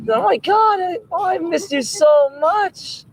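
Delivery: very high, drawn-out dog voice; overjoyed dog. Acted. Dog telling someone it missed them, high slow squeal. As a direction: squeal it as a lovestruck dog, stretching it out.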